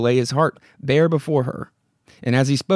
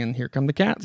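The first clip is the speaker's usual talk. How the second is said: usual speaking voice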